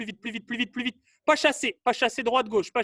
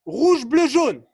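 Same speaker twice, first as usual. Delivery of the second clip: loud colour calls, raised pitch; called-out command; 3 semitones higher, 7 dB louder, much slower than usual. Real, not acted. Coach sends players to coloured cones, loud call. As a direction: fire out each colour as an order.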